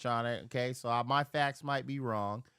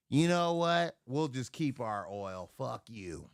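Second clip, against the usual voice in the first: ordinary delivery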